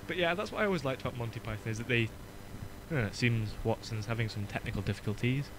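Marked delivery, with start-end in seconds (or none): none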